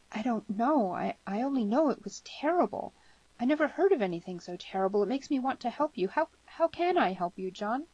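put-on high, stammering, troubled girl; distressed sympathy. Girl distressed, offers help.

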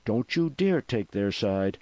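a sharp, heated telling-off; snapped. A narrator's reading. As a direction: snap at him with blazing indignation. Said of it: "eyes blazing, and snapped".

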